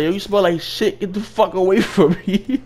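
high, loud retold insult; laughing mock outrage. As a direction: yell it while cracking up. Retold insult while cracking up.

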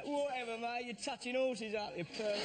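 a hint of a swaggering Londoner, slowish; cocky Londoner retort. Londoner dismissing the actor with a lightly voiced jeer. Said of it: "swaggering Londoner".

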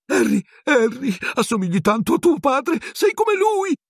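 very high, pleading squeak; high-pitched cringing wretch, begging; trembling Pettigrew. A narrator's reading. Pettigrew fawning on Harry over his father, trembling plea. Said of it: "tremando in maniera incontrollabile".

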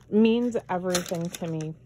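usual speaking voice

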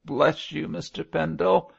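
put-on crying like a child; sobbing. A narrator's reading. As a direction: burst into grateful sobs. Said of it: "Mosk burst out crying like a child".

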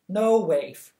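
firm stern no to a dog. Acted. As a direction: scold the puppy firmly. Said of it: "She pointed sternly".

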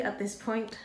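normal talking voice

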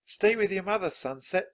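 put-on high, loud girl's shout to the horse. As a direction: shout it across the canyon. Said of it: "shouted Annie".

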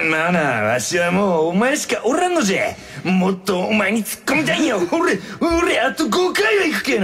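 put-on Hiroshima-dialect old man building to a shout. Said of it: "なんでこんな広島弁に戻ってんの？". (広島弁で叫ぶように)

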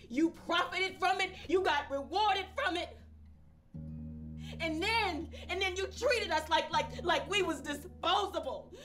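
high, loud accusing yell; furious shout. Acted. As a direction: rage boiling over at the betrayal. Grieving parent accuses, escalating.

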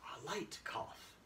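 very soft, slow, nearly voiceless; quiet, drawn-out repeat; hushed demonstration. Shown as a demonstration. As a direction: say it slowly under your breath. Demonstrating a light cough softly.

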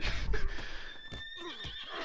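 very high airy laugh that rises; helpless squeaky laugh; breathless high giggle; wheezing squeal of laughter. Real, not acted. Laughing after getting knocked out.

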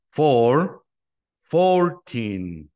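modeling four and fourteen. Shown as a demonstration. Teacher models number pairs.

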